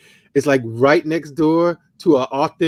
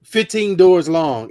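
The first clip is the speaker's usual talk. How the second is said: normal talking voice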